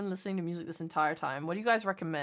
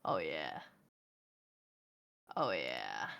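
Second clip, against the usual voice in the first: very low, soft oh yeah; savouring the music; 11 dB quieter, slower than usual. Real, not acted. Streamer settling into relaxing game music, low satisfied murmur. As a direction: drop right down, quietly satisfied.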